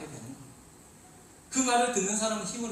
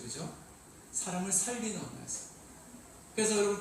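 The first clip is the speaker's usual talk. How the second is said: ordinary delivery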